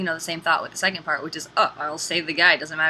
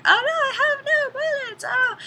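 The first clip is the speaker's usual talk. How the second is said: much higher, whiny hammed-up cry; mock panicked wail. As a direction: fake despair of a helpless damsel. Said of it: "and she's like".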